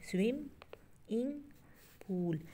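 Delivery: स्विम and इंग high and loud, pause after each; lifted, separated स्विम-इंग-पूल; syllable by syllable. Shown as a demonstration. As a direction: punch स्विम, इंग, पूल as three beats. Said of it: "इसके three syllables हैं".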